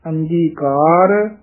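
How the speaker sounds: ordinary talk, nothing marked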